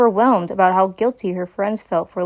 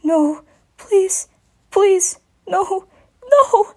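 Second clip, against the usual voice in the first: put-on high young dragon begging, rising to a louder final no; nightmare pleading; 11 semitones higher, 3 dB louder, much slower than usual. Baby dragon begging in his nightmare, frightened and high. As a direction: plead in terror from a bad dream.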